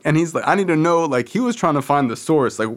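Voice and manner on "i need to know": insistent demand. Retold or imitated. Dad demanding source.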